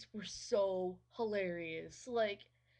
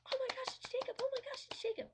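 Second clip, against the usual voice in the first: much higher, squealing mock gush; gushing excitement; faster than usual. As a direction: squeal it like a giddy fangirl. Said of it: "she gets all".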